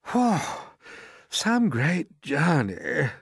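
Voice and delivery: slow, high, tired sigh of relief; worn-out centipede after a rough ride; weary centipede. A narrator's reading. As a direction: drag it out like an exhausted traveller. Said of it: "limping across the room".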